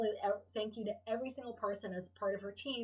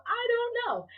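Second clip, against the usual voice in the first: high, loud, carefree reenacted reply; breezy admission; 14 semitones higher, 6 dB louder, slower than usual. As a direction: toss it out high and unbothered. Breezy reply to goal doubters.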